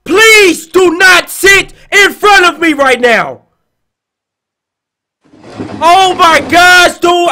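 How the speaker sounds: loud, high, drawn-out begging; panicked pleading. Real, not acted. Streamer begs it not to sit in front, shouting in panic. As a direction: plead at the top of the voice.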